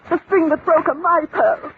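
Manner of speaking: lady claiming the pearls as hers, shrill with rising outrage; indignant protest. Acted. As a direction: insist they are hers, climbing in pitch.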